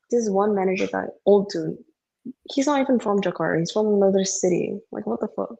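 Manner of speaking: ordinary talk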